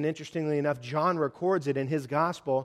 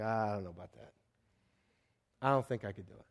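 soft, low, doubtful mumble; reluctant backing out; 6 semitones lower, 8 dB quieter, faster than usual. Acted. Preacher admitting he would tap out, quietly reluctant. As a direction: back away quietly in a lowered voice. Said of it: "at that point I tap out".

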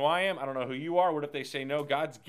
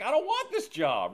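high, louder mock whine; whiny Moses; 12 semitones higher, 7 dB louder than usual. Moses refusing God's job offer, high mock whine. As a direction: whine it like a reluctant kid. Moses' fear voiced as complaint.

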